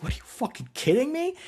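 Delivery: imitating indignant disbelief, pitch jumping high; high-pitched mock outrage at normal volume; retold incredulous outrage. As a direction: act out a scandalized, disbelieving comeback. Acts out her angry disbelief.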